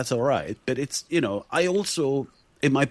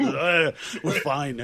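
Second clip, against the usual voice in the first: laughing; 4 semitones higher, much slower than usual. Retold or imitated. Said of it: "And I'm like".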